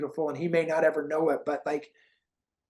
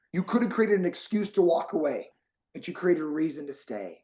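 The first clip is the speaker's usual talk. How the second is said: ordinary talk, nothing marked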